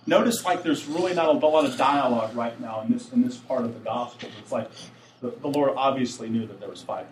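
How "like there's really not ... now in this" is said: normal talking voice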